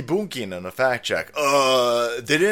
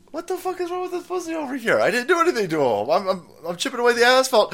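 fast, higher-pitched exasperated firefighter; baffled firefighter; 8 semitones higher, faster than usual. Acted. Firefighter complaining about the protester, baffled and rushed. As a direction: rattle it off in high-pitched bafflement. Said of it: "the firefighter is like".

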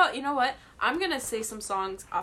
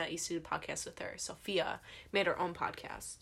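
whispering; 4 semitones lower, 11 dB quieter than usual. Real, not acted. Whispered gossip.

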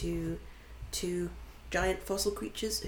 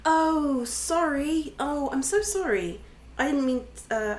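flustered apology; 8 semitones higher, 6 dB louder than usual. Acted. Realizes she's holding the mate.

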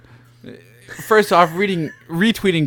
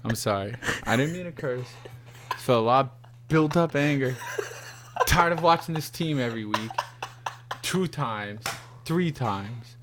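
sheepish apology; 5 semitones lower, 9 dB quieter than usual. Real, not acted. Sheepish apology after rant.

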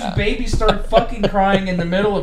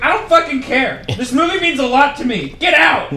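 raised, higher voice, building to an order; mock angry outburst; 6 semitones higher, 4 dB louder than usual. Moviegoer orders a drunk woman out, mock furious. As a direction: imitate a fed-up moviegoer losing it.

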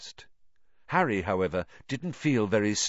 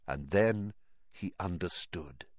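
ordinary delivery

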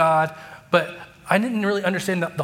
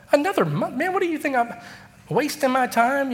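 acted-out squeal of frustration; exasperated protest; 10 semitones higher than usual. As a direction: shoot way up in pitch, incredulous. Retold exasperated protest to his friend.

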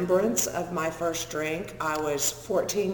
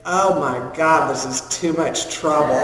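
a fed-up cowboy giving up; exasperated cowboy; 7 dB louder than usual. Retold or imitated. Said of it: "the only thing I remember him saying is".

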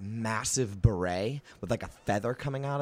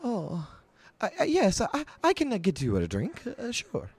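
much higher, eager stammering writer; Volo; 11 semitones higher than usual. Acted. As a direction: fuss and fluster like a pompous little chronicler. Volo again.

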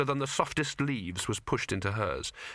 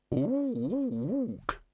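high hooted ook; ape ook; 10 semitones higher than usual. Retold or imitated. Imitating the librarian.

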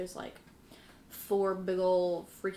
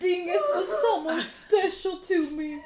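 hammed-up high sentimental quote; pitched up, laughing at the sentiment; mock heartfelt; 8 semitones higher, 4 dB louder than usual. Player imitates a distraught character mourning Dingus, mock heartfelt. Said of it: "He's like".

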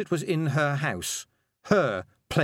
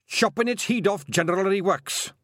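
Scottish brogue; 9 semitones higher, 4 dB louder than usual. A narrator's reading. Feegle reply in Scots voice.

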